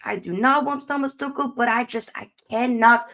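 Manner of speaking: usual speaking voice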